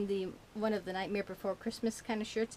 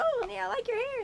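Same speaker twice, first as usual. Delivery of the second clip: high fake-sweet compliment; 13 semitones higher, 4 dB louder than usual. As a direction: gush it falsely. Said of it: "It's like".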